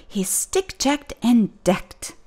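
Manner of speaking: plain reading voice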